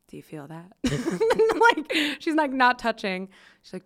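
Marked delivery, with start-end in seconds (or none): 0.1-0.7 soft hushed question, mocking a fake healer. Friend hushing her hands near mine, a soft mock-mystic question. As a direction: ask it softly like a pretend energy healer. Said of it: "A S M R".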